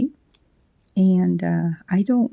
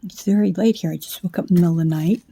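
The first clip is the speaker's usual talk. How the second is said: ordinary talk, nothing marked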